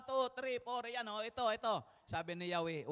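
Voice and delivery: ordinary talk, nothing marked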